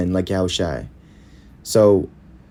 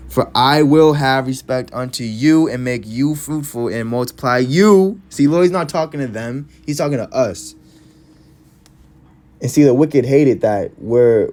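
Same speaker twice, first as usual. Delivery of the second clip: preaching at full force; 4 semitones higher than usual. Real, not acted. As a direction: rise to the pulpit climax. Preacher rising to climax.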